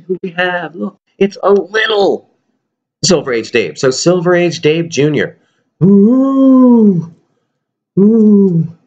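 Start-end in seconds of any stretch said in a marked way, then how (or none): 5.8-8.8 high, loud ghostly ooh; spooky ooh. Pretend. Playing with the puppet to spook viewers, ghostly ooh.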